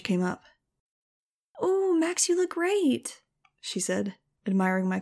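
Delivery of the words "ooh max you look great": put-on much higher, gushing young girl voice; gushing Nudge. Nudge admiring Max's new clothes, high gushing girl. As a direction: squeal it like an excited kid sister.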